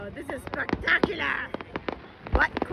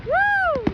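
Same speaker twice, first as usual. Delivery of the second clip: high-pitched "woo"; high cheering whoop; excited whoop; 13 semitones higher than usual. Real, not acted. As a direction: whoop along with the crowd. Cheering at fireworks show.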